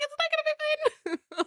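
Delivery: high, quiet panicked squeak; panicky Olive. Retold or imitated. Host voicing Olive refusing comfort, high panic. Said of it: "That was a great Olive impression".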